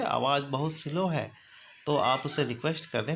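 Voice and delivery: ordinary talk, nothing marked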